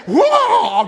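loud, high whoa of alarm; startled loud exclamation; shocked whoa. Retold or imitated. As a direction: cry out at the sight. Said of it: "It's like".

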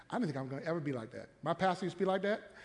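ordinary talk

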